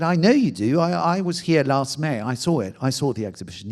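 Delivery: loud, high, offended protest; indignant retort. Retold or imitated. Indignant retort to museum official.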